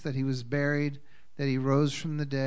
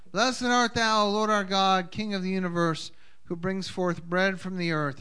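formal blessing; 4 semitones higher than usual. Acted. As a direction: proclaim the blessing solemnly.

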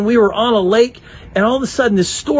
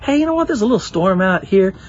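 lower, laid-back understatement; mock casual; 5 semitones lower than usual. Disciples calmly mention the storm, mock casual. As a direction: shrug it off like nothing's wrong. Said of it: "They weren't going".

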